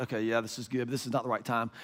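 low, soft reluctant mutter; hesitant aside. Retold or imitated. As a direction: drop low and quiet, as if privately conflicted. Own reluctant reaction to call.

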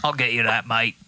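easygoing Australian reply; Australian accent. Acted. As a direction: answer the demand as an Aussie mate. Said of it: "I can do Australian pretty good".

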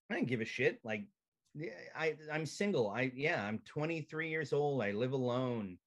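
ordinary delivery

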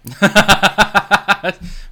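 high, loud belly laugh; loud burst of laughter. Real, not acted.

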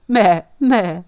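two loud goat bleats; imitated goat's meh. Goat bleats after answering.

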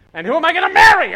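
high, loud, fast panicked cry; panicked outburst. Retold or imitated. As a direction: blurt out the fear. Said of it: "you're like".

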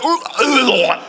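loud, high imitated retching groans; mock disgusted groan. Imitates manager reacting with arm in toilet.

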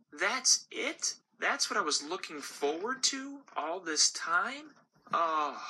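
a discarded candy asking why; dejected gumball. Acted.

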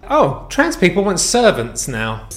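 ordinary delivery

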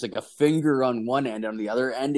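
ordinary talk

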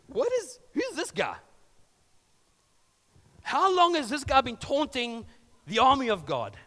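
who does this guy think he is; young David, incredulous at the taunting giant; indignant disbelief. Retold or imitated.

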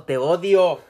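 loud mock declaration of hate; shouted for comic effect; joking outburst. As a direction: belt it out as a joke. Jokey outburst at absent girlfriend.

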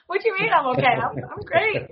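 high, offended "what do you mean"; mock offended protest. Telling the boss she's great, mock offended. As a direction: play it hurt and indignant, half joking.

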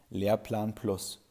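usual speaking voice

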